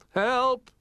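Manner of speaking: high, loud cry for help; a stuck little bear yelling help; shouted help. Acted. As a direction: call for help while dangling. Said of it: "he shouted".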